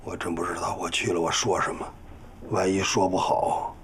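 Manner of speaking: low, subdued hesitation; downcast, softly backing out; reluctant worry. Acted. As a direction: murmur doubts about going. Father reluctant to go apologise.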